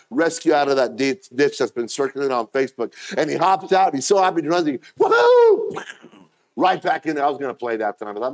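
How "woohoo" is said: very high, loud whoop of joy; gleeful whoop. Retold or imitated. Rescued sheep running off gleefully, very high loud whoop. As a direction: let out a wild happy yell.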